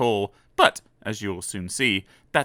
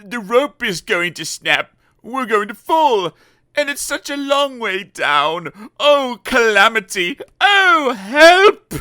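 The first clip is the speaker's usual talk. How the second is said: high, loud, slow panicked wailing; rising hammed-up terror up to the cry for help; 12 semitones higher, 8 dB louder, slower than usual. Mister Brave fearing the rope will snap, high panicked wail.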